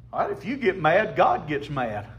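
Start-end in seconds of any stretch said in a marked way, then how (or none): none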